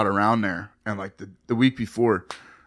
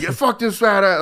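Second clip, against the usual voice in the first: higher, mild mock yell across the street; mock yelling woman; 7 semitones higher than usual. Rejected woman yells at the bouncer from across the street, with a mild mock yell. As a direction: imitate her yelling from afar, lightly. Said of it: "like, yelling".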